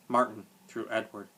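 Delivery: usual speaking voice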